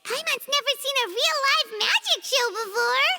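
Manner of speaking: high, squealing excitement; bubbling over with anticipation; excited. Acted. Paimon squeals to the Traveler as the show starts, thrilled.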